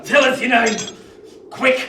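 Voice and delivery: loud, high shouted order; barked demand. Acted. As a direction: bark it to force an answer. Convict demands the boy's name.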